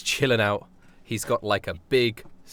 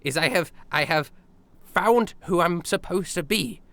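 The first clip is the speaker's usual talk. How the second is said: eager tailor rat; 8 semitones higher than usual. Acted. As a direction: announce your new self with pride. GM voicing Douglas the tailor rat.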